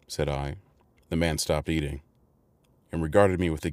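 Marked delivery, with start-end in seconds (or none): none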